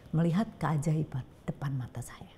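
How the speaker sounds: hushed, breathy whisper of awe. Real, not acted. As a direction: drop to a near whisper in wonder. Awed testimony of a daily miracle. (berbisik penuh kagum)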